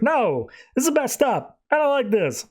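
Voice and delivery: high-pitched imitated distress; mildly acted alarm; upset refusal. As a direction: protest high, as if wanting out. Wonder Woman rejects the vision.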